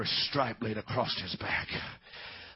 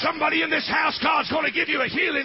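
high, loud shouted preaching over music; full-force preaching; 7 dB louder than usual. Acted. Pastor urging the congregation to rise, shouted preaching. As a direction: preach it out to the whole church, climbing.

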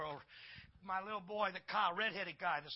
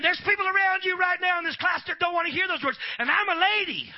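high, loud acted indignation; fed-up scolding; 11 semitones higher, 11 dB louder than usual. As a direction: tell off the class at full volume. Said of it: "Finally, she had enough".

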